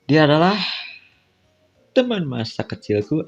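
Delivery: normal talking voice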